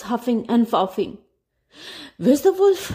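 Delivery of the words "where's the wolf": high, loud alarmed question; breathless villagers. A narrator's reading. Villagers reaching Rufus, high alarmed question. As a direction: gasp it out after the run. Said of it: "they asked".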